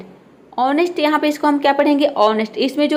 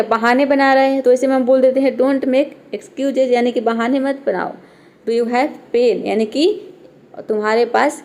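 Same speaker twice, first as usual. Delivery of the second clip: normal talking voice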